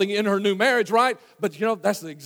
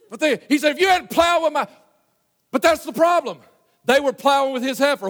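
high, loud preaching shout; preaching at full force; 7 semitones higher, 6 dB louder than usual. Real, not acted. Preacher warns congregation, loud fervent preaching. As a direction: drive the warning home like a revival preacher.

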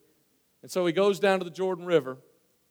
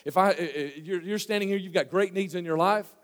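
ordinary talk, nothing marked

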